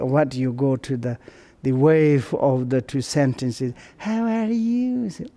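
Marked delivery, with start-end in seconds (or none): 4.0-5.1 "How are you" high and sing-song; demonstrated intonation. Shown as a demonstration. Showing the wave of the sentence, "How are you" pitched high. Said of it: "intonation or like kind of the".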